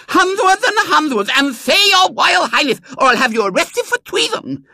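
put-on very high, loud lisping child; shrieked royal threat from a small tyrant; imperious little girl. Small girl threatening her coachman with treason, loud and imperious.

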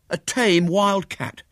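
slow, high, loud marvelling; amazed. Acted. William marvels that the creature is tame, amazed. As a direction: stretch it out in wonder.

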